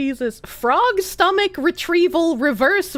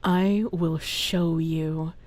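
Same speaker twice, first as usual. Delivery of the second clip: much lower, slow, soft menacing voice; movie villain threat; 10 semitones lower, 6 dB quieter, slower than usual. Pretend. Streamer playing a menacing villain toward a viewer. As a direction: threaten them like a film villain, low and slow.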